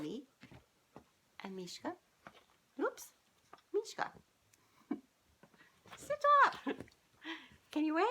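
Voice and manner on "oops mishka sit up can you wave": high, louder coaxing pet voice; pet talk. Real, not acted. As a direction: coax the pet in a squeaky voice. Talking to her pet Mishka.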